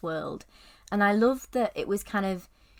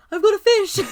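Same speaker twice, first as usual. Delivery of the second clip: excited shout; 13 semitones higher, 13 dB louder than usual. Retold or imitated. Excited quote from the film.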